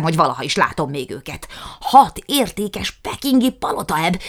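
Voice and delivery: loud, high indignant tirade; loud, climbing complaint; outraged rant. A narrator's reading. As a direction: fume over the lost profit. Jukrics ranting about lost dogs.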